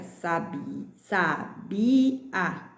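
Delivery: "sabia" split sa - BI - a, weight on bi; slow beats, middle syllable of "sabia" stressed. Shown as a demonstration. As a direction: sound out sa, BI, a. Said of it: "separando as sílabas".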